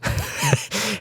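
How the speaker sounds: short, high chuckle; amused chuckle. Real, not acted. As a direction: chuckle briefly at your own anecdote. Chuckles at the retold hang-up.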